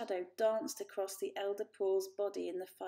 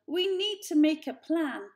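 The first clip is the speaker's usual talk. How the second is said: louder, higher, urgent; 7 semitones higher, 8 dB louder than usual. A narrator's reading. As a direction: fight to be heard. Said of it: "struggling to regain control".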